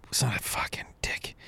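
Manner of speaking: hissed under the breath; whispered curse. Real, not acted. Hiccup again, muttered curse.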